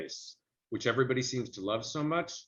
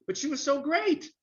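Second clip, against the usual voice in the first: much higher, amazed; mock Jerry's disbelief, pitched way up; surprised Jerry. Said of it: "And he goes".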